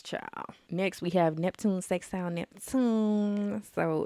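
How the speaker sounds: usual speaking voice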